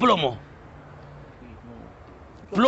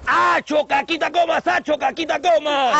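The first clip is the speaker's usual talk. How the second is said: higher, projected bus tout's call; street-call demonstration; 4 semitones higher, slower than usual. Shown as a demonstration. Driver teaches new conductor the route call, calling out. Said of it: "Escúchame bien lo que te voy a decir".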